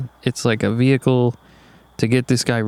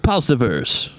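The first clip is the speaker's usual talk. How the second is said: news anchor voice; slower than usual. Shown as a demonstration. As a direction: announce it like the evening news. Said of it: "You gotta use the news anchor voice".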